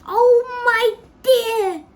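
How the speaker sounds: high, loud wail; wailing grandmother, loud and high; distressed cry. A narrator's reading. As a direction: cry out in dismay. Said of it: "cried Grandma Georgina".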